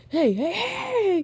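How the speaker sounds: high, drawn-out "hey"; calling out. Acted. Toya trying to catch up to siblings, calling out. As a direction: stretch out a high call to someone leaving. Limping brother calls after siblings.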